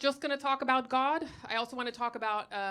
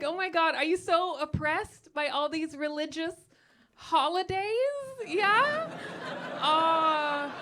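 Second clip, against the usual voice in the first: mock pity; 5 semitones higher than usual. Comic pitying atheists over religious holidays, with mock sympathy. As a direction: coo over their tiny hardship, hammed-up.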